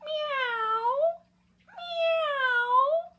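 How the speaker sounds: very high, loud, drawn-out meows; cat meow. A narrator's reading. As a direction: cry out like a cat. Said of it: "cried the cat".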